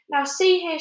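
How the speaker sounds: high, loud stern call; shouted. A narrator's reading. Police chief shouts up at the centipede. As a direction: demand attention from the roof. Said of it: "shouted the chief of police".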